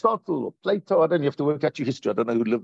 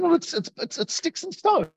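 high-pitched, stammering brush-off; retold philosopher waving it away in a higher pitch; mock dismissive; 14 semitones higher than usual. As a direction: imitate a baffled sceptic, pitched up. Acted philosopher dismissing the prophet's grief.

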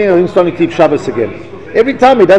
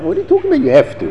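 incredulous; 10 semitones higher, faster than usual. Real, not acted. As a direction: scoff at the excuse. Mocking the "I have to" excuse.

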